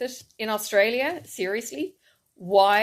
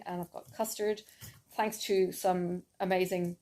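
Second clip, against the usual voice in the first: ordinary talk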